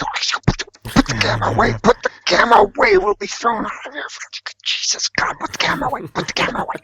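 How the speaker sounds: hushed and panicky, high; urgent whisper. Retold or imitated. Begging the art dealer in a hushed panic to hide the camera. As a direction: hiss it so nobody hears. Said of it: "I'm whispering this to him".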